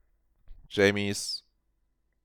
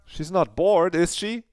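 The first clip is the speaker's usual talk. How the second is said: rising worried question, pitched high; a nervous jolt of doubt, higher; anxious; 11 semitones higher, slower than usual. Acted. Claire fearing Jamie is bored, anxious and higher.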